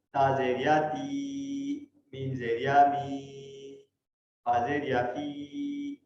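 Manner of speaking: very slow, تی می فی drawn long; long i vowel. Shown as a demonstration. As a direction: stretch the ی in فی. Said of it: "اس میں لمبا آواز کریں".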